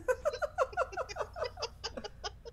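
high, real giggle fit; helpless giggling. Real, not acted. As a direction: giggle uncontrollably. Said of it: "can't stop giggling".

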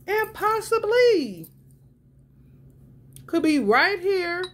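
high, loud and slow; excited drawn out. Retold or imitated. Telling her friend the figure could be real, high and slow. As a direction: stretch it out in excitement.

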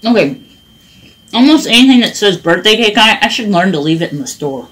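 eating between sentences; talking while chewing. Real, not acted. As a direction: mouth partly full of cereal. Seen 1: Bite taken at -2; talks over bowl with spoon throughout.

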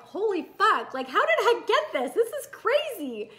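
high-pitched, amused disbelief; acted astonishment. Coach voices client landing a dream life, astonished. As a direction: imitate someone amazed by their own luck.

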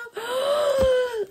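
high, crying gasp of joy; huge squealing intake of breath; overwhelmed gasp. Real, not acted. Overcome by the signed gift.

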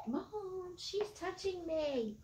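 put-on high whiny kid tattling to mom; whiny tattling little sibling. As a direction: whine it like a tattling child. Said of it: "kinda like having your kids start yelling at each other".

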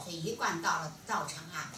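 normal talking voice

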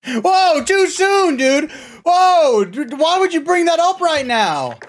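high, drawn-out protesting yell; mock outrage. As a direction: overblown offended wailing at a friend. Mock outrage over 9/11 joke.

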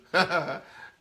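amused laugh. Real, not acted. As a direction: laugh at the newlyweds' troubles. Amused by the newlywed's troubles.

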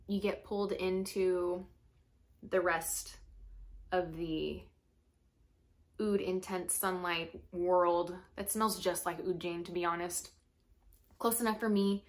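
ordinary delivery

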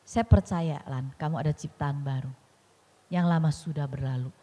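low, steady imitation of a husband; husband's reassurance. Husband holding his upset wife and affirming her, calm. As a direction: voice a calm husband, pitched well down. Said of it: "Suami saya selalu ucapkan begini".

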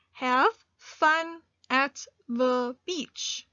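very slow, word by word; slow dictation read. Shown as a demonstration. Teacher dictating the rest of the sentence to learners, very slow. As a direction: spell it out slowly for the class. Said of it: "continue with our sentence".

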